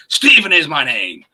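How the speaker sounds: loud, slow, hammed-up Irish brogue; Irishman's line. [name] answering the quiz with Stephen's line, in a loud Irish brogue. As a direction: proclaim it like the wild Irishman. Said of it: "the answer to your question is".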